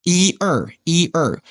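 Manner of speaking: "Yi er" with a long ee in Yi; full vowels. Shown as a demonstration. Teacher counting one two in Mandarin, full vowels in "Yi er". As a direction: say "Yi er" in full, not shortened. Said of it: "instead of saying "yi er," they say it very short".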